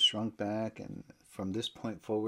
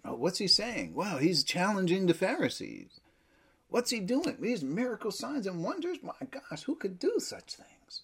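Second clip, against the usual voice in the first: voicing the crowd with growing wonder, climbing much higher; from curious to astonished, higher and higher; imagined amazed onlookers; 9 semitones higher, 4 dB louder than usual. Preacher voicing crowds amazed at Jesus's miracles, growing wonder. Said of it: "They would have thought".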